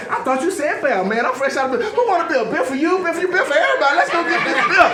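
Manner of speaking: high, building to loud babble; frantic eagerness. Retold or imitated. Man at gunpoint suddenly agreeing, frantic mock eagerness. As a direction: offer it to everyone, increasingly loud.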